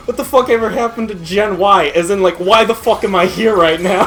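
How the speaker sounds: mock outrage. YouTuber complaining in the commercial, loud mock outrage. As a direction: throw up your hands in mock fury. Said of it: "He's like".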